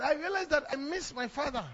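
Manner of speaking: ordinary talk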